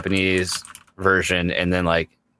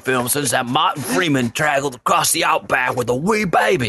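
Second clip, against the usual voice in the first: high mock Aussie accent, half sung; Australian accent; 5 semitones higher than usual. Joking about Australian films, high mock Aussie accent. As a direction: ramble it like a cartoon Australian.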